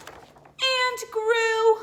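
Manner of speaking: high, loud amazed; big amazed storyteller voice; wonder. A narrator's reading. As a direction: marvel as it keeps growing.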